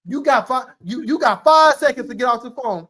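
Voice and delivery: high, loud countdown warning; parent's ultimatum. Retold or imitated.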